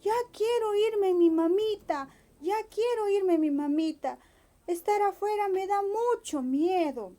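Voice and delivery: high, pleading bunny voice; calling for his mummy, frightened; homesick little rabbit. Acted. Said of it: "el conejito dijo". (conejito asustado, llamando a su mamita)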